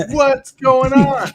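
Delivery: high, loud and very slow; mock bewilderment. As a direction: draw out the confusion. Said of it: "I'm like".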